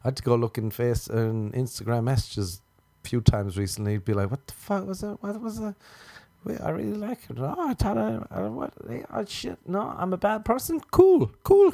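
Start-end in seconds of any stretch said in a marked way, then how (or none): 4.3-10.8 high, soft, flustered self-talk; he realises he forgot her; panicked inner voice. Retold or imitated. Inner panic remembering a girl. 10.9-11.7 very high, loud strained cool; forcing himself to be okay; squeaky fake calm.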